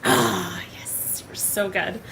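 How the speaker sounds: breathy whisper, almost no voice; hushed, awed whisper; whispered praise. Real, not acted. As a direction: whisper the compliment like a secret. Gushing compliment after answer.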